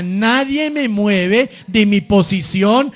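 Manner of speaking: slow and loud, building to CRISTO; loud slow declaration. Shown as a demonstration. Preacher leads the congregation in a loud slow vow. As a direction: proclaim it like a soldier's vow.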